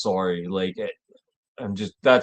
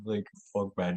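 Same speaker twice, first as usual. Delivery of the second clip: ordinary delivery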